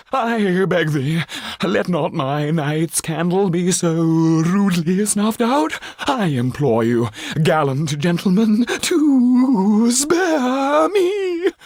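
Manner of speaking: high, slow, theatrical pleading cry; pompous thespian begging for his life; pleading actor. Acted. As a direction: beg like a hammy stage actor. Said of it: "I am but a poor thespian".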